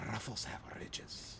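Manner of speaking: whispered Ruffles have ridges; whispering. Shown as a demonstration. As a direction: breathe the slogan in a whisper. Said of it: "Ooh, I did that well".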